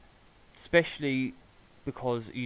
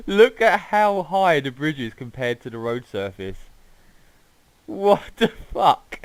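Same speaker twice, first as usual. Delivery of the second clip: laughing disbelief; 5 semitones higher, 10 dB louder than usual. Real, not acted.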